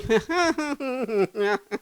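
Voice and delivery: high, soft chuckle; quiet run of giggles; laughing. Real, not acted. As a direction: laugh lightly at your own remark. Preacher laughs at own remark.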